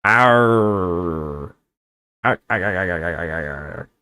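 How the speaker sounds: mock pirate growls, fading out; a string of hammed-up pirate arrs; pirate arr grunts. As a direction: throw in some crab captain arrs. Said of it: "if you have the mannerisms".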